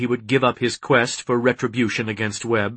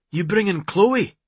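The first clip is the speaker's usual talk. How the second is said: higher-pitched, quick eager character voice; eager ally; 8 semitones higher than usual. A narrator's reading. Ronan telling his friend of the assault, eager. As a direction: pitch it up, rattle off the plan.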